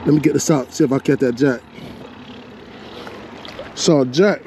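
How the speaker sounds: ordinary talk, nothing marked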